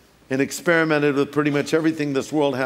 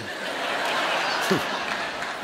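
breathless laugh; 4 semitones lower, 8 dB quieter than usual. Real, not acted. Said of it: "never mind".

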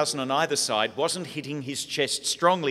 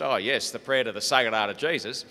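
lightly voiced Australian rancher; a hint of broad Australian uncle; Australian accent. Uncle Morris voiced on phone.

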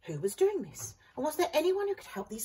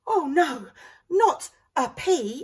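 higher, louder, slow horrified wail; gasping dismay; 8 semitones higher, 9 dB louder, slower than usual. A narrator's reading. Vegetables horrified to hear about the pea. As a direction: gasp it in mock horror. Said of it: "everyone gasped".

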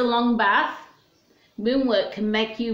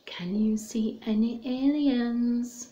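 secretive whispered question; 4 dB quieter than usual. Acted. Teacher whispering to the children at story time. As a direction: lean in and whisper it to the kids.

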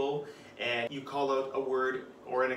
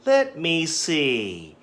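slow, drawn-out "Let me see", pondering; thinking aloud; 3 semitones higher, 6 dB louder, much slower than usual. Shown as a demonstration. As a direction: stretch it like you're still thinking. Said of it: "this is a thinking expression".

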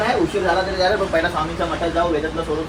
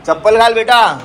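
calling out; 6 semitones higher, 6 dB louder than usual. Real, not acted. Father hurrying his son before school, raised call. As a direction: hurry him into his sandals.

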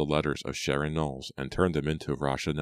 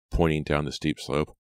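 plain reading voice

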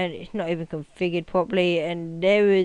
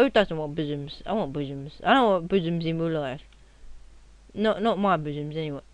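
ordinary delivery

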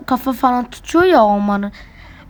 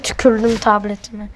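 ordinary talk, nothing marked

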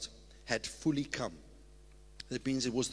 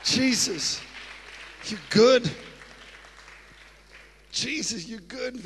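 much higher and louder, shouting praise; ecstatic praise; 9 semitones higher, 16 dB louder, much slower than usual. Real, not acted. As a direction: cry out to Jesus in joy. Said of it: "having a good time with Jesus".

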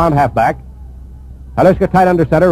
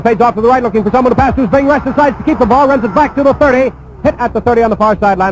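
higher, quicker play-by-play as he scrambles; rising excitement; 6 semitones higher than usual. Real, not acted. Announcer calling the quarterback's scramble, excited. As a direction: lift the voice with the run. Runner breaks away on radio call.

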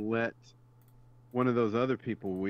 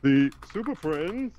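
higher, louder cartoon narrator voice; Ted Knight; 5 semitones higher, 7 dB louder than usual. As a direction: attempt an old superhero-show narrator. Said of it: "I can't do the Ted Knight voice".

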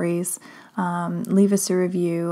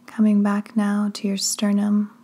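slow, hushed delivery in short phrases with long pauses; drawn-out words, each phrase left to settle; guided sleep meditation; 4 dB quieter, slower than usual. Acted. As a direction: lead them gently down into sleep, unhurried.

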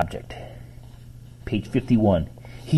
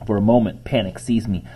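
ordinary delivery, nothing marked

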